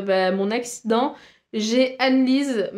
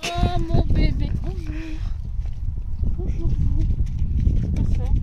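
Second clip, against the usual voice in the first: cooing; 5 semitones higher than usual. Real, not acted. Cooing to horse.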